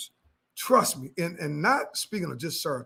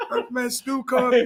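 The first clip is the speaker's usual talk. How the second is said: ordinary delivery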